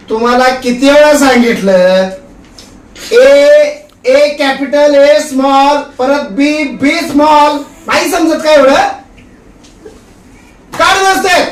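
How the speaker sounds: losing his temper. Acted. Husband who preached love ends up yelling at the kids. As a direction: patience snaps, shout at the kids. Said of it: "तर लगेच तुमचा आवाज चढला".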